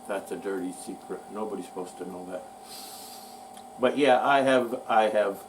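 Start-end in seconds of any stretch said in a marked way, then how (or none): 0.1-2.4 low, soft and fast, a mock secret; hushed aside. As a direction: drop to a quick conspiratorial hush.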